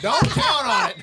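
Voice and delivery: high-pitched, delighted read-out; amused proclamation, pitched right up; laughing announcement. Real, not acted. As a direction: read the verdict out through laughter. Reads out eight ball's verdict, amused.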